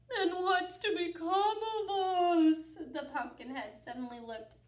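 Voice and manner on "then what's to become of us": sad spooky creature. Acted. Pumpkin sad.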